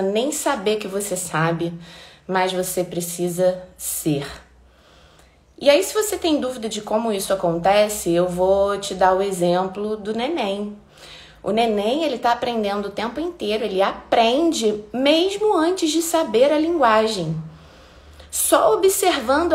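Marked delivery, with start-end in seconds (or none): none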